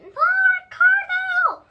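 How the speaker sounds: very high, loud, slow desperate shout. Acted. Junie screams after Ricardo as he runs off. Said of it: "I shouted".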